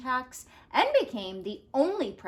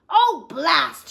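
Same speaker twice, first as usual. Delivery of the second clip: loud exasperated blast; shouted; 5 semitones higher, 10 dB louder than usual. Acted. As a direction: bark it out in frustration. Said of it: "shouted Taft".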